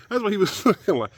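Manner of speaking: laughing. Real, not acted. Laughing at his own joke.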